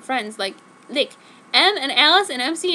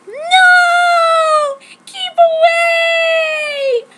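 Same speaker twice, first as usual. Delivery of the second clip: very high, very loud drawn-out scream; long shrieking cry of fear; 11 dB louder, much slower than usual. Acted. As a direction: scream for help in terror. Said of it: "I screamed".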